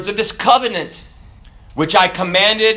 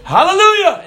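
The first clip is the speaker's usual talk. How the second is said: higher, louder "Hallelujah" called out; loud command; 10 semitones higher, 4 dB louder than usual. Acted. Priest calls the car into motion, loud. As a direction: call it out to start the car.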